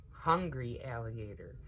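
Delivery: plain reading voice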